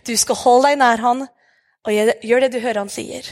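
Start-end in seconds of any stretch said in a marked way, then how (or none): none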